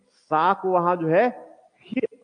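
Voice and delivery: हा in place of सा, breathy h instead of s. Shown as a demonstration. Teacher shows how Persians turned sa into ha. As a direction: say हा with an h sound, not सा. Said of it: "वो सा को जो है सा उच्चारण नहीं कर सकते".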